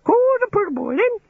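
very high parrot screech; squawking budgerigar. A narrator's reading. Birds in the bushes taunting the digger, squawking. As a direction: squawk it like a pet budgie.

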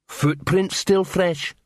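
a dim troll spelling it out; slow troll voice. Acted.

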